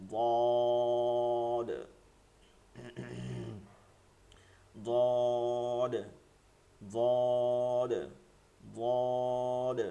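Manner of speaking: বদ held long on a level note, ending on a heavy Arabic dad; makhraj demonstration. Shown as a demonstration. Teacher demonstrates the tongue placement to students, makhraj demonstration. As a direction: draw out বদ evenly, tongue side against the upper back teeth. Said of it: "দাঁতের আবার গোড়াতে লাগবে".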